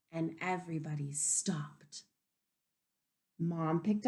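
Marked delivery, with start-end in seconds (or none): 0.1-2.0 whispered hush as everyone freezes; hushed storybook whisper; suspense whisper. Acted.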